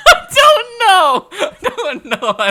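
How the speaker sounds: very high, helpless laughing cry; laughing outburst. Real, not acted. Flustered speaker asked what they mean, high laughing wail. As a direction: squeal it through real laughter.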